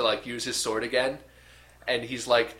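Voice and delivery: usual speaking voice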